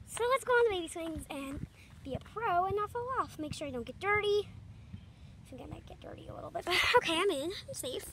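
ordinary talk

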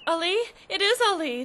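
a mother hearing her lost son named; shocked recognition. Acted. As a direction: gasp out the name in disbelief. Mother recognises her son's name.

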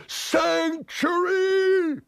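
very high, hugely stretched wail; desperate imploring. Acted. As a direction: wail the plea out as long as it will go. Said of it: "he implored".

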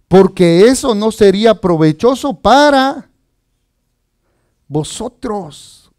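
slow, deliberate reading, phrase by phrase; solemn scripture reading. Real, not acted. Preacher reads a verse to the congregation, solemn and measured. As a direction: read the verse out slowly, weighing each word.

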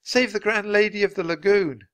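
high, imploring call; calling plea. A narrator's reading. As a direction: beg a giant from afar. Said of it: "called Annie".

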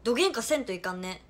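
loud, punchy Hakata dialect; forceful demo of heavy Hakata dialect; thick Hakata dialect. Shown as a demonstration. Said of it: "どぎつい博多弁喋って".

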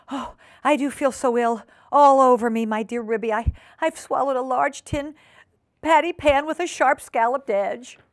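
high, hammed-up suffering; wailing invalid Duchess. Duchess describing her symptoms to Ribby, wailing. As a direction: moan loudly about feeling ill.

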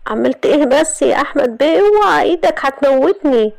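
high, strained pleading voice of a sly man; Ayman being choked. A narrator's reading. Ayman begs Ahmed to let go of his collar, high and strained. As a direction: squeak it out as he's being choked.

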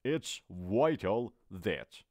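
It's vital that drawn out slowly; teacher repeating it's vital that very slowly. Shown as a demonstration. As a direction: stretch every word of It's vital that.

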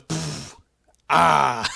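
spray it and bellow; imitating the Triple H water spit; mock spit and shout. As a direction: spit then a loud yelled ah. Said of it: "he goes".

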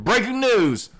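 high, punchy announcer shout; mock breaking news. Host mock-announces the news to listeners.

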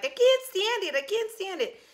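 very high, nasal-free squeal of a film star; squeaky movie voice. Retold or imitated. Quoting the Singing in the Rain line on her video. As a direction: squeak it like a silent film actress. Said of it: "and I was like".

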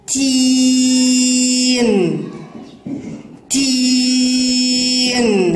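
slow, sustained تين; long madd vowel. Shown as a demonstration. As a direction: hold the ee of تين very long before the n. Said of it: "هل تستمعوا لهذا الصوت؟". (مدّ الياء طويلًا في تين)

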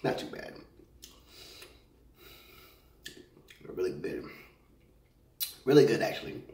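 talking between bites; mouth full, chewing. Real, not acted. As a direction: food in mouth. Seen 0: Jaw and lips working, tongue visible, mouth partly full between words.

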